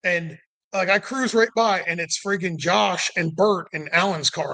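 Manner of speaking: usual speaking voice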